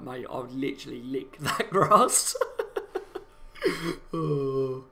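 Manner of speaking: dissolving into laughter; laughing. Retold or imitated. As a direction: laugh through the quote. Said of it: "I'm like".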